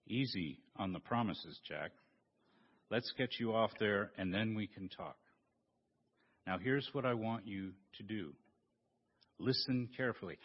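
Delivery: low, soft divine voice calling down; voice of God. Acted. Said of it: "I'm God".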